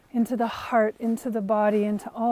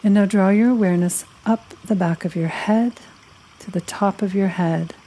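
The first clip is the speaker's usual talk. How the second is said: guided meditation; 4 semitones lower than usual. Acted. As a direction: lead them unhurriedly through a body scan, phrase by phrase. Leads a guided body-scan meditation.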